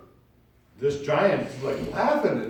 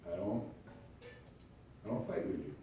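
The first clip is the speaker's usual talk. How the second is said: low and much softer, almost to himself; a hushed, calm refusal; quiet refusal; 4 semitones lower, 15 dB quieter than usual. Acted. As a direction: pull back and say it softly. Refusing the quarrel.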